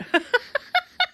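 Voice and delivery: a quick high laugh, rising. Real, not acted. Laughing after do it.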